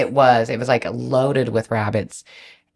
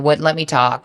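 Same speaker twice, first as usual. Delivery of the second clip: normal talking voice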